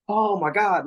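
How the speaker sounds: gasp, then a high, slow oh my God; mock roller-coaster gasp. Describing the rush of a roller coaster, mock gasp. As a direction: act out the thrill of the drop.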